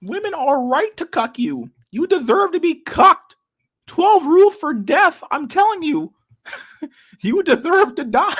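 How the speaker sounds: high, slow, strained mock lecturer voice; hammed-up high parody voice; mocking impression. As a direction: sneer it out in a squeaky parody of a stern pundit. Mocking a famous psychologist voice.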